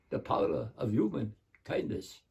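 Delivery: put-on soft Hungarian Dracula accent; Bela Lugosi. Dracula closes the kindness poem, hushed Hungarian accent. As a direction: close it quietly as a Hungarian vampire. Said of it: "This is him saying my poem".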